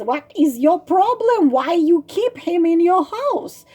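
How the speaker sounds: exasperated storybook bird; acted exasperation. Bird questions the girl about her frog, exasperated. Bird challenges the girl's choice.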